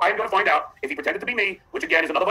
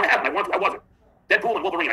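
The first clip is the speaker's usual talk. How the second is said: ordinary delivery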